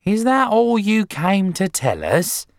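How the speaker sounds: high, loud, scornful demand; screechy, impatient wife; ratty. A narrator's reading. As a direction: challenge the visitor, thoroughly put out. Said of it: "ratty about missing her programme".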